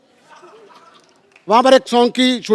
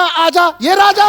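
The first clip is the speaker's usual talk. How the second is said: mock calling; 4 semitones higher, much slower than usual. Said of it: "किसी ने ये नहीं कहा".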